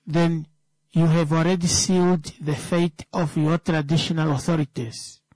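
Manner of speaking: normal talking voice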